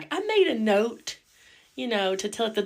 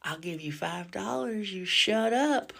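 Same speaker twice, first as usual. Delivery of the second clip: put-on menacing old lady; 6 dB quieter than usual. Grandma threatens granddaughter.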